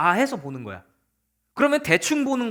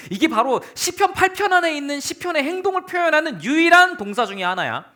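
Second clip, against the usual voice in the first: ordinary talk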